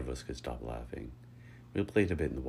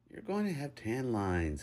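high, drawn-out complaint; complaining; 11 semitones higher than usual. A narrator's reading. Lucas on the narrator's shorts, complaining. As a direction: grumble it high and slowly. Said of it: "he complained".

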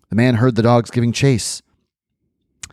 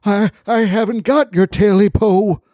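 high, stammering terrified man; frightened stutter; 11 semitones higher than usual. Acted. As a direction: stammer it out in panic. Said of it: "the man stuttered".

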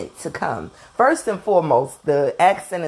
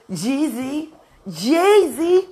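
excited; 9 semitones higher, 4 dB louder, much slower than usual. Real, not acted. Calling out the rapper's name.

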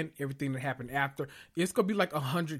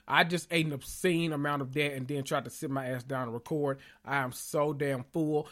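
usual speaking voice

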